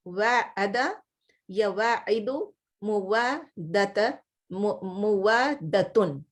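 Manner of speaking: slow, careful Arabic forms again; slow repeat of forms. Shown as a demonstration. Teacher repeating the verb forms for her class, slow and careful. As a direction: drill the forms slowly for students. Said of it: "repeating verb forms".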